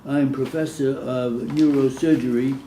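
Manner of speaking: normal talking voice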